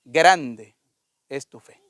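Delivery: lightly acted pronouncement, word by word, dropping to a hush; retold Jesus. Jesus raises the woman up, solemn and lowering. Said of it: "Y Jesús la levantó y le dijo".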